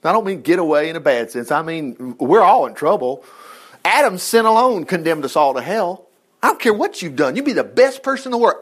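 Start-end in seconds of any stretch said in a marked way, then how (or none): none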